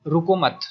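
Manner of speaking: रुको मत said as one joined group, then a stop; pause after मत. Shown as a demonstration. Teacher showing the phrase split the other way, रुको मत as one unit. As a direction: bind मत onto रुको and break off after it. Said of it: "phrase दूसरी अलग कर दी".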